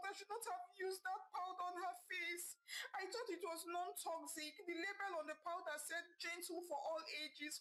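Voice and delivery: high, tearful wailing plea; weeping uncontrollably. A narrator's reading. Mother weeping at the hospital over her baby, wailing. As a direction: sob it out as if it's all your fault. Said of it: "Zena said as she wept uncontrollably".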